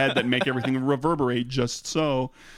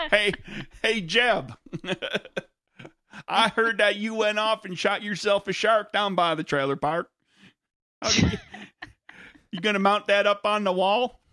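hillbilly voice; 7 semitones higher than usual. Acted. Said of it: "break out those voices again".

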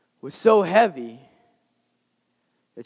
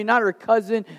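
normal talking voice

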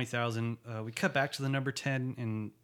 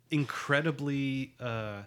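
usual speaking voice

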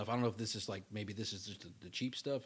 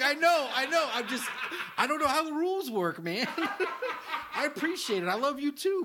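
high-pitched giggly voice, cracking up; laughing so hard the voice goes up high; laughing high retelling; 9 dB louder than usual. Retold or imitated. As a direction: squeak through the laughter. Retold awkward reply, high laughing voice.

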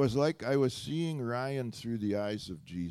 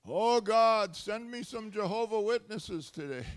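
higher, louder, hammed-up pleading; mock eager prayer; 10 semitones higher, 4 dB louder than usual. Preacher acting out his cheeky home prayer, higher and louder. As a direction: beg God like a mischievous kid. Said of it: "I have a prayer that I pray".

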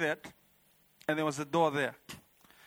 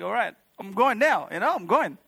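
excited announcement, higher and louder; eager; 4 semitones higher, 6 dB louder than usual. Retold or imitated. Dreamer reaching for heaven, eagerly setting off. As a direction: say it raring to go.

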